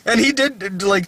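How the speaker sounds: ordinary talk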